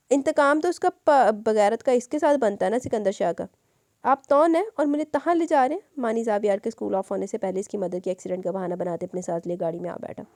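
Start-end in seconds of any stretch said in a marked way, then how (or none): 4.0-5.8 put-on child's voice. Child asks in car.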